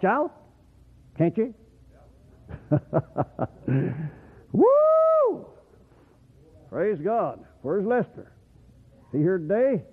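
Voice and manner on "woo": loud high woo; joyful whoop. Real, not acted. Preacher whooping to the congregation, loud and high. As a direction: let out a shouting whoop.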